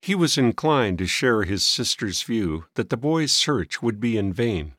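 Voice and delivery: plain reading voice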